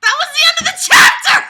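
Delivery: shrieking high and loud with glee; ecstatic shout. Real, not acted. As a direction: yell it out in triumph. Reader erupts at chapter end.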